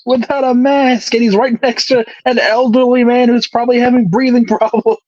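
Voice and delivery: high-pitched mock alarm; fretting in pretend horror, pitched high; mock panic. Co-host mocking fears of catching covid, mock panic.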